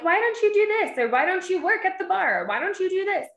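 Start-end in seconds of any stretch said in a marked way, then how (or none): 0.0-1.0 much higher, pushy mock question; mock nagging. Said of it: "people are like". 1.1-2.4 mock nagging. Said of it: "people are like". 2.5-3.3 mock nagging. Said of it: "people are like".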